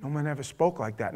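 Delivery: hushed awe. Acted. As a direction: let it land quietly. Repeats the officers' awed answer.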